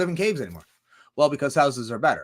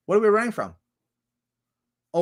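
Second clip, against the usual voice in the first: normal talking voice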